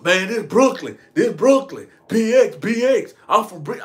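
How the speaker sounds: insistent tough-guy chant, voice pushed up; imitated hollering. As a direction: holler it like a blustering loudmouth cut short. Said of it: "he keep hollering all this".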